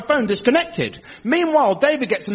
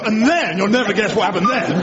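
ordinary talk, nothing marked

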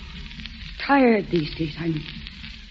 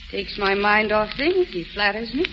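usual speaking voice

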